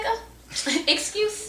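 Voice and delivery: squeaky high excited voice; high-pitched quote. Retold or imitated. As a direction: jump way up in pitch. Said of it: "And I was like".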